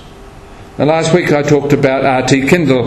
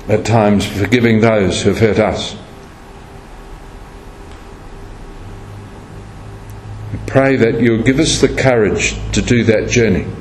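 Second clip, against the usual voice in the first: low, hushed prayer voice; a pastor's soft closing prayer; 3 semitones lower, 4 dB quieter than usual. Acted. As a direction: pray it gently and reverently. Closing prayer after sermon.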